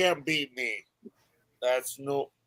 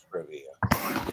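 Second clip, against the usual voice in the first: whisper; 12 semitones lower, 8 dB quieter than usual. Real, not acted. Whispered aside.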